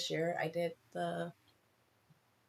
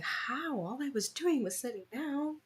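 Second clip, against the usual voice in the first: incredulous; 8 semitones higher than usual. Retold or imitated. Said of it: "and I was like".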